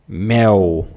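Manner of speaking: MEL ending in a w-like glide, "méu"; loose-tongued L. Shown as a demonstration. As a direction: say mel Brazilian-style, tongue left loose. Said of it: "Nós falamos o L com a língua solta". (mel com L solto, "méu")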